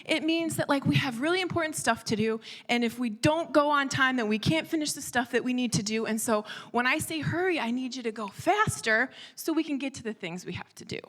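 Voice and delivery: retold snap of a frazzled parent; snapping. Mother explains hurry to daughter, snapping in exasperation. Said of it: "So I kinda snap".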